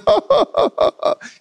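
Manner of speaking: high choked laugh-cry; teary laugh-sob. Retold or imitated. Father breaking down at the gift, high laughing sob. Dad crying on son.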